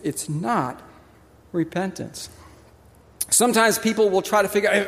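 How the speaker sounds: usual speaking voice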